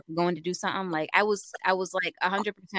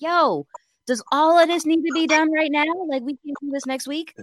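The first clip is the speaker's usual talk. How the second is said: higher, louder fed-up protest; a coworker's exasperated plea; exasperated pushback; 8 semitones higher, 4 dB louder than usual. Retold or imitated. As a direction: push back like you've had enough. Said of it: "I'm like".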